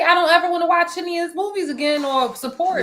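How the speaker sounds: high put-on voice of an angry fan; high mock fan. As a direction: play the huffy fan swearing off his movies. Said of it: "And you could say".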